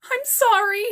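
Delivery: apologise, stung and near tears; hurt apology. Acted. Scolded daughter apologising to her mother, hurt.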